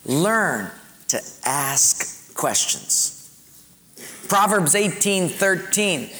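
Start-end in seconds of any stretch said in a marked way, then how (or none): 0.1-3.1 slow, spaced-out words; slow dictation. Real, not acted. Preacher repeating the bullet to congregation, slowly. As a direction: pause between words for note-takers.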